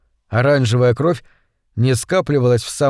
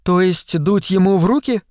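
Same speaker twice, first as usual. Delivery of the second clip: higher-pitched disbelief; incredulous question; 5 semitones higher than usual. A narrator's reading. Tina questions Bancroft's artificial breathing idea, incredulous. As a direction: ask it as if you can't believe the suggestion. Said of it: "недоверчиво спросила Тина".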